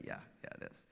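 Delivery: breathy whisper without voice. Retold or imitated. Father whispering back to his son during communion. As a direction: whisper it so the church won't hear.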